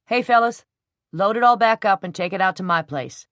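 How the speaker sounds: loud call to the movers; loud call out the door to the fellas. A narrator's reading. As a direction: shout across to the helpers. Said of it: "whistled piercingly".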